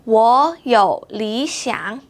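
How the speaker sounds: 我有理想 very slow with sandhi on 我 and 理; slow, each tone marked; slow tone demo. Shown as a demonstration.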